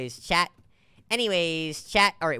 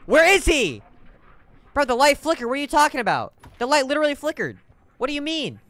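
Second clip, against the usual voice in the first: agitated; 7 semitones higher, 5 dB louder than usual. Real, not acted. Panicked arguing.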